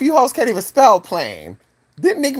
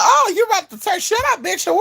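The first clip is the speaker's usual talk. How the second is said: high-pitched mock accusation; accusing listener. Offended listener catching the speaker, accusing. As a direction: call out the slip in a high voice.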